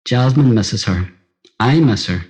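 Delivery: usual speaking voice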